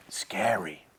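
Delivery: hushed, low whispered aside; spooky whisper. Acted. Storyteller showing children the lurking villain, spooky whisper.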